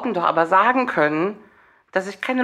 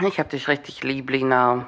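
soft, low and sincere; tender; 6 semitones lower, 3 dB quieter than usual. Real, not acted. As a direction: say it softly and truly. Sincere affection to friend.

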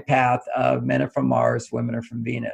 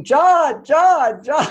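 imitated shriek of his name, much higher and louder; retold screaming; 11 semitones higher, 6 dB louder, much slower than usual. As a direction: call out his name like a wife alarmed across the house. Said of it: "she started screaming".

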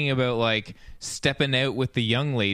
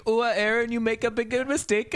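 higher, sing-song fake Jamaican accent; mock Jamaican accent; 8 semitones higher than usual. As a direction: ham up a faux Jamaican accent. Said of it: "faux Jamaican accent kinda thing".

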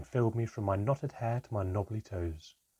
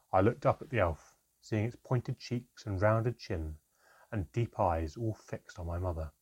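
plain reading voice

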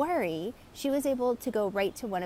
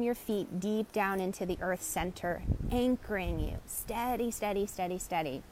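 guided grounding; 2 semitones lower, 4 dB quieter than usual. Shown as a demonstration. Coach guiding listeners to ground, soothing fade. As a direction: lull them down into the earth.

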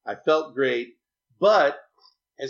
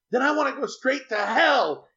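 put-on child's outburst; 11 semitones higher than usual. Upset child retold, outburst.

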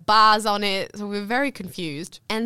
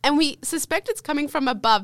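ordinary delivery

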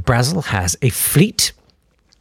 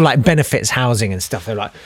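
normal talking voice

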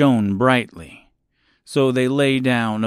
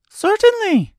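very high, louder mouse queen; squeaky little voice; 5 dB louder than usual. A narrator's reading. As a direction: answer in a tiny mouse squeak. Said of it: "in her squeaky little voice".

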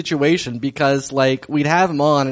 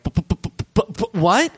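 stammering shock; 6 semitones higher than usual. Retold or imitated. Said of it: "Nunu Gordon's like".

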